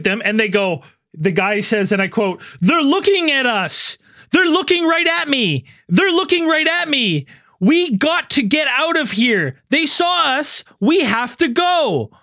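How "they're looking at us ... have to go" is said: high, slow, over-enunciated line reading; hammy found-footage actor, each line drawn out; mock movie acting. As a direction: quote it slowly in a stilted, raised voice. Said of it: "Who talks like that?".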